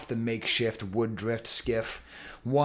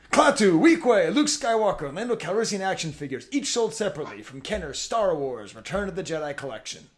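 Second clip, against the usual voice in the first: high, loud toy ad announcer; voice of God; 4 semitones higher, 5 dB louder than usual. Acted. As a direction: boom it like a commercial narrator. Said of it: "The voice of God comes back to say".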